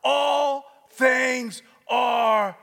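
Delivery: rising, shouted preacher cadence; preaching at full force. Acted. Preacher to congregation, building to a shout.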